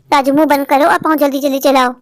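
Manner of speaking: normal talking voice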